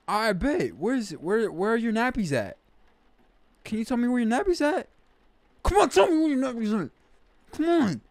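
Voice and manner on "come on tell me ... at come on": high, louder and fast coaxing; pleading, urging. Real, not acted. Urging the baby to show where its nappies are, coaxing.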